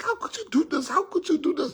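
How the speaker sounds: high-pitched tearful wife impression; mock crying. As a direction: wail it as a betrayed wife. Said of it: "this woman started to cry".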